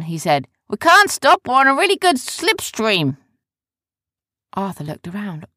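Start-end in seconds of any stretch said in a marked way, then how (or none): 0.7-3.2 loud, high command; brash hollered instruction. Acted. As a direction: order them aboard from the deck.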